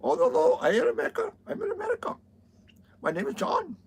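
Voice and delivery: very high mock Indian accent; squeaky Indian call center voice. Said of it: "thick Indian accents like that".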